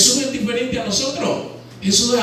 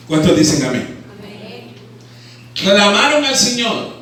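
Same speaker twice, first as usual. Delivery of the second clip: ordinary delivery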